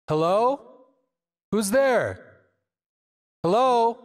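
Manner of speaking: calling out into an open space with delay. Shown as a demonstration. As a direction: call out with airy distant echoes. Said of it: "Now we have this open space".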